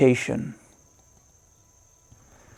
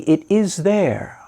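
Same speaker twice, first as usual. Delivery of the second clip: ordinary talk, nothing marked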